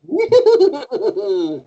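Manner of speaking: high, drawn-out cartoon cackle; Koro Sensei laugh. Shown as a demonstration. Demonstrating his favorite role for the interviewer, high character laugh. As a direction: let out a long, high, wavering character laugh. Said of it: "and he goes".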